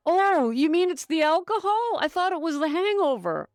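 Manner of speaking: high, loud dopey surprise; mock clueless voice. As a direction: play dumb, wide-eyed. Mocks a clueless drinker.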